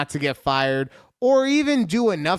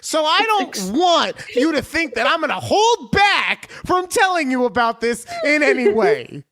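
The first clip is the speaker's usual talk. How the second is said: mock-offended rant; 9 semitones higher, 4 dB louder than usual. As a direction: stretch the key words in hammed-up outrage. Mock-offended rant acting out the horoscope.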